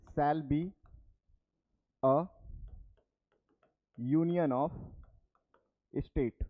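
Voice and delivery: word by word, long pauses between phrases; slow dictation. Shown as a demonstration. Teacher dictates Article One for students to write, slow dictation.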